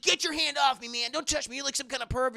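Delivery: high, loud mock yell at the teacher. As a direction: yell him off like an angry teen. Yusuke yells at the teacher.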